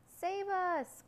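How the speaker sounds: high, loud plea to be rescued; frightened cry. Acted. Third frightened voice begging rescue, high loud cry. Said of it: "cried the third".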